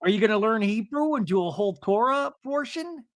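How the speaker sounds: high-pitched mock-eager question; put-on high husband voice; Seth imitation. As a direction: ask it high and goofy. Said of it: "my Seth imitation".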